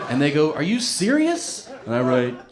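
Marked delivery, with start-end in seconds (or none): 0.5-1.7 high and slow disbelief; mock-shocked nurse; shocked question. Hospital staff reading heroin note, shocked.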